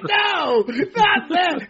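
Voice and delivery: high, loud, laughing mock shout; mock protest. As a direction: cry out in hammed-up objection, half laughing. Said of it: "He's like".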